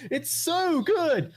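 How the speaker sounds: high-pitched, drawn-out mock gushing; mock fanboy. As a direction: imitate a blindly devoted fan, pitch way up. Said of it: "And like".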